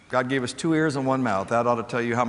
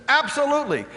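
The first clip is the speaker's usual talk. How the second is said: excited yes; 4 dB louder than usual. Retold or imitated. Kamal jumps on table, agrees.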